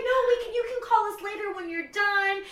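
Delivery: high, loud, sweet voice; accommodating family. Retold or imitated. Family offering to call later, high and sweet. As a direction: offer kindly to call back. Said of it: "They're like".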